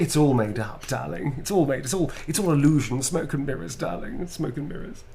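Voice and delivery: put-on theatrical assumed accent with swooping pitch; Astarian. Admitting the accent is invented, in arch character voice. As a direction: play it as an arch, lilting confidence. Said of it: "Astarian's accent is actually not based on mine".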